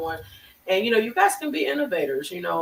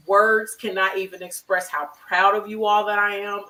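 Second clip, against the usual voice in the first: normal talking voice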